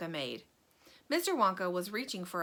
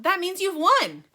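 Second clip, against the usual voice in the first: loud, high burst of joy; excited cry; 10 semitones higher, 10 dB louder than usual. A narrator's reading. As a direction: explode with excitement. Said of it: "he cried out".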